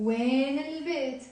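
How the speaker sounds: "When البيت" as one stretched word; liaison. Shown as a demonstration. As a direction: slide from "When" into البيت with its L sounded. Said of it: "link it with a liaison".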